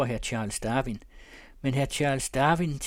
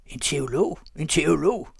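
raised, rising shout from the crowd; higher, louder crowd cry; called out; 4 semitones higher, 6 dB louder than usual. A narrator's reading. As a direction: cry it out as a heckler, louder the second time. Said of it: "Råbet kommer fra de trofaste".

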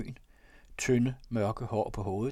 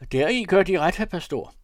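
loud, raised mock gravity; higher, emphatic pretend solemnity; feigned seriousness; 8 semitones higher, 9 dB louder than usual. A narrator's reading. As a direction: agree with put-on earnestness. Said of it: "med påtagen alvorlighed".

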